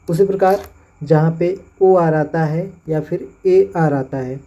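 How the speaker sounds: ordinary talk, nothing marked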